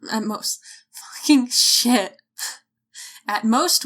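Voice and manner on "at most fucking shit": slow frustrated whisper; whispered curse. Real, not acted. Stumbling over the line again, she curses in a slow whisper.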